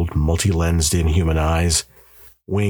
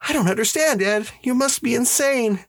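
much higher voice for a bewildered wife; disbelieving wife. A narrator's reading. Wife telling her husband she doesn't understand, bewildered. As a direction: play a wife who doubts her husband's sanity.

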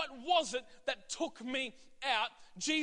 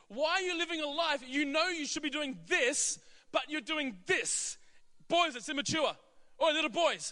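higher, louder chiding call; Jesus calling out; 5 semitones higher, 3 dB louder than usual. Retold or imitated. Jesus chides disciples back at fishing, higher and louder. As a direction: playfully scold the lazy disciples, laughing at first. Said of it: "what he was saying was this".

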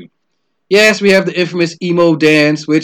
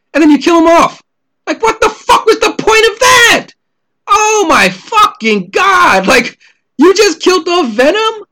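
high, loud, disbelieving yell; shouting in outraged disbelief, words stretched; 12 semitones higher, 5 dB louder than usual. Real, not acted. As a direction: explode at the pointless death. Outrage at Venom's death.

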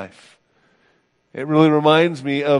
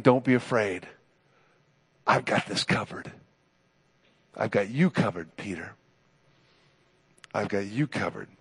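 gentle reassurance; 3 semitones lower than usual. Retold or imitated. Jesus reassuring Peter, voiced softly.

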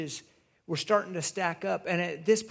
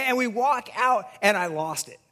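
ordinary delivery

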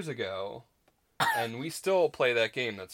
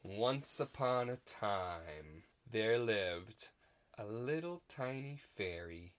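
storytelling; 11 dB quieter, slower than usual. Acted. Storybook opening.

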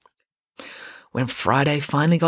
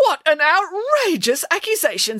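high, indignant teacher protest; mock outrage; 13 semitones higher, 3 dB louder than usual. A narrator's reading. Mrs Cannon protesting Friday's accusation, indignant. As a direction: huff it out, offended.

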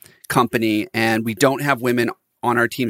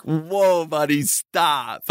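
high, drawn-out disbelieving protest; exasperated halt; 3 semitones higher, much slower than usual. Retold or imitated. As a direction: stop them short, amused and incredulous, stretching it out. Retells halting a biased client.

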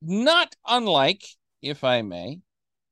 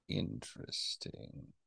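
slow, breathy whisper, much softer and lower; whispered interesting; 10 semitones lower, 20 dB quieter, slower than usual. Shown as a demonstration. Cohost mulls over a surprising claim in a slow whisper. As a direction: let the word fade to a slow whisper. Repeats word, fading to whisper.

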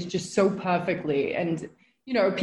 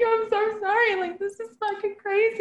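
much higher, teary mock sob voice; high teary retelling; 14 semitones higher than usual. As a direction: replay her overwhelmed self, pitched way up. Retelling her tearful reaction.

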